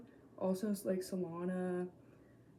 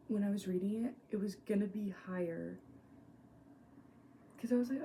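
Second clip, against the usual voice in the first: usual speaking voice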